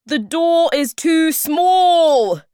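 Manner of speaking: high, slow, drawn-out yell; shouting. A narrator's reading. Hemulin stuck outside the cellar, loud drawn-out shout. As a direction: wail the complaint at full volume. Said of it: "he shouted".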